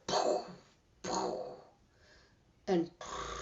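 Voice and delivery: soft puffed gunshot noise; imitated distant gunshots. Hearing shots while caught in barbed wire, soft imitated gunfire. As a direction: imitate far-away shots with breathy poofs. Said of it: "I hear".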